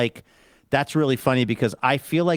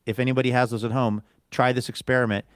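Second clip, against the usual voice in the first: normal talking voice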